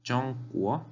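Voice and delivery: "Chongguo" with high level "Chong" and rising "guo"; carefully separated tones on "Chongguo"; first and rising tones. Shown as a demonstration. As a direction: hold "Chong" high, then lift "guo". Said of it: "Primer tono".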